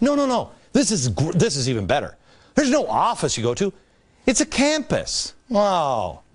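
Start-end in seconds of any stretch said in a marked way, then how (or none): 4.3-5.3 "campus" slow with "camp" leaned on; stress on camp. Shown as a demonstration. Mocking Foxconn's campus, stressed on camp. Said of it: "emphasis on the first part of the word".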